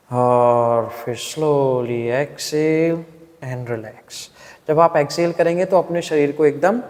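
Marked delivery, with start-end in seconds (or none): 0.1-4.2 very slow, low, fading breathing cue; guided breathing. Shown as a demonstration. Teacher leading students to exhale and relax, slow and soft. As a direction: let the words melt into the out-breath. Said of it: "किस तरह से".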